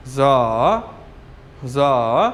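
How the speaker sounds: जा low, dipping then rising; third tone. Shown as a demonstration. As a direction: dip जा low and let it climb. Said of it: "हल्की आवाज़ से शुरू करेंगे और भारी कर लेंगे". (जा को हल्की आवाज़ से शुरू कर भारी करें)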